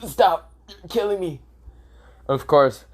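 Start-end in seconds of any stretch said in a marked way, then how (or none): 0.0-1.4 put-on high, pinched complaint from a crushed boy; mock strangled protest. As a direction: play being squeezed too hard, pitched up. Boruto squeezed in tight hug.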